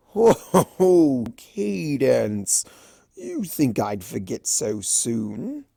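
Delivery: hammed-up indignation; mock-offended Discord. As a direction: trickster feigning insult at the princess.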